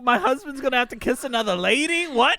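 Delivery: high, loud hammed-up possessive wife; loud pretend outrage; mock jealous wife. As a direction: play the jealous wife big. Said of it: "She's like".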